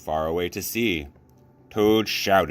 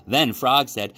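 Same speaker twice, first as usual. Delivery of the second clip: usual speaking voice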